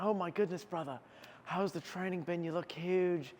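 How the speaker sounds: put-on high, excited South African accent. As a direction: greet him like a thrilled South African bro. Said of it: "what a South African accent sounds like".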